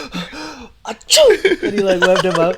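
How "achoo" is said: loud, high imitated sneeze; mock sneeze. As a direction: burst out with a fake sneeze.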